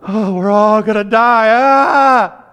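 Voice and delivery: hammed-up terrified cry; mock panic. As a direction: scream like the boat's sinking. Acting panic in a storm.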